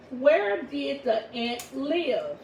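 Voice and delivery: very slow, drawn-out teacher reading; slow read-aloud. Shown as a demonstration. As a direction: read it out slowly for the class. Teacher reads exercise sentence out loud.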